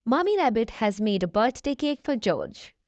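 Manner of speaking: ordinary delivery, nothing marked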